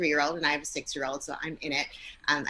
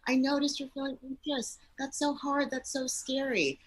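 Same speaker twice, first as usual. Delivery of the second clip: soothing parent; 7 semitones higher than usual. Shown as a demonstration. Parent soothing anxious child.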